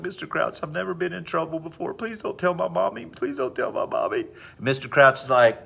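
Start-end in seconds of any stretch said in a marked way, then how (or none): 0.0-4.3 high, whimpering schoolgirl plea; hammed-up crying voice, pitched up; mock tearful pleading. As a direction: beg like a kid who's in trouble. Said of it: "she just starts crying right there".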